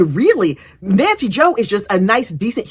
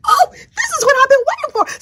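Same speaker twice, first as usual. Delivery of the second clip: high squealing glee, almost crying; squealing excitement. Retold or imitated. Narrator squeals with joy at the courtroom drama. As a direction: squeal it out with delight.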